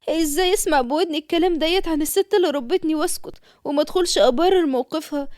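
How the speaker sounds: normal talking voice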